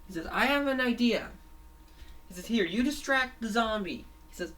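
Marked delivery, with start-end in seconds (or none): 0.3-1.3 high, loud cartoon voice; eager announcement. Fango shares a plan with Fangy, eager announcement. 2.5-4.0 high-pitched cartoon voice giving orders; urgent instruction. Fango hands Fangy his job, urgent instruction.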